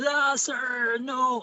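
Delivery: high, drawn-out groan of protest; whining students. Retold or imitated. Class protests the teacher stopping the story, high whiny groan. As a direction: beg to hear the ending now.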